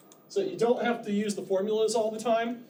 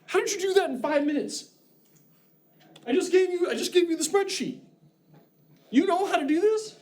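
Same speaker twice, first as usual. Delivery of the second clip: amazed boss; 9 semitones higher than usual. Retold or imitated. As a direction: play the stunned boss who can't believe it.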